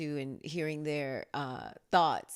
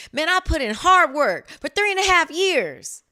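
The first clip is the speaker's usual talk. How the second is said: higher and louder, hammed-up complaint, fast at the end; mock exasperation; 9 semitones higher, 10 dB louder than usual. Mom jokingly complains to her son, loud mock exasperation. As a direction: play-act the hurt mom who did all the work. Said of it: "And I'm like".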